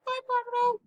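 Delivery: very high squeaky goodbye; little cartoon voice; squeaky tiny voice.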